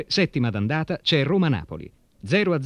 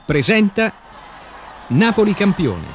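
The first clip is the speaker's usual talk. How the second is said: announcer voice; 4 semitones higher, 4 dB louder than usual. Acted. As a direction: boom out the show title. Program title announced loudly.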